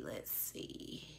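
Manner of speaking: breathy whisper to herself; whispered let's see. Real, not acted. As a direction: murmur it under your breath. Searching comments on her iPad.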